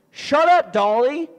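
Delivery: loud, high "shut up", easing off on the name; mock scolding yell. Sinner silencing the parrot that reveals sin, mock yell. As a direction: playfully snap at a nagging bird.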